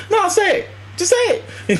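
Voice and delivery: high-pitched insistent dare; imitated goading in a high voice; Samuel L. Jackson insisting. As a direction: push them, voice way up high. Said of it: "Samuel L. Jackson was like".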